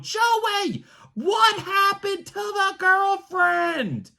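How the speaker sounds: high, slow, drawn-out taunting yell; obnoxious heckling. Retold or imitated. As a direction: stretch every word out to carry across the ballpark. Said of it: "I'm looking right at him going".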